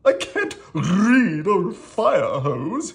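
high, loud, drawn-out royal grumble; slow, high-pitched kingly complaint; grumbling king. A narrator's reading. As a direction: grumble loudly like a storybook king. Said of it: "he grumbled".

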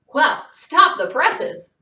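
slow loud mock announcement; called out. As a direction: call out the joke across the room. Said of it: "Mister Bendel called".

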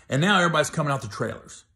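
ordinary talk, nothing marked

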